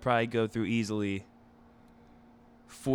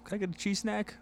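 put-on higher, softer small-child voice; imitated little daughter; 8 semitones higher, 6 dB quieter than usual. Daughter asking dad for a snack, imitated child voice. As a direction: mimic a kid quietly asking a favor. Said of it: "And she's like".

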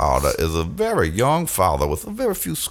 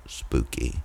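spooky whisper; 10 semitones lower, 10 dB quieter than usual. Acted. Storyteller builds suspense.

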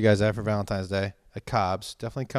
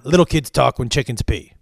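mock scolding; 6 dB louder than usual. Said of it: "his favorite thing to say was".

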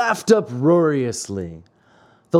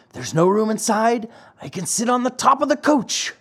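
lightly voiced boy Lampwick; 6 semitones higher than usual. A narrator's reading.

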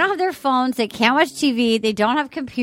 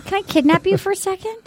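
high put-on cutesy voice; lightly mocked high-pitched sweet talk; imitating Alea; 7 semitones higher than usual. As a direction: say it high and coy, mimicking her. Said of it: "She goes".

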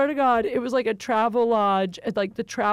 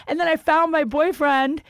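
ordinary talk, nothing marked

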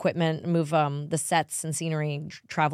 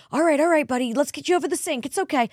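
higher, hurried soothing dad voice; husband soothing child; 10 semitones higher, 4 dB louder than usual. Retold or imitated. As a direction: play the calm dad tending a nosebleed.

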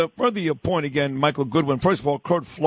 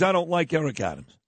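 usual speaking voice

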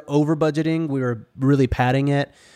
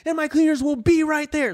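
much higher, mock salesman excitement; hyped-up pitch; 14 semitones higher than usual. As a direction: oversell every fix to the guest. Hyped pitch of fixes to guest.

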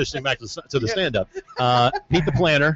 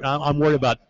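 usual speaking voice

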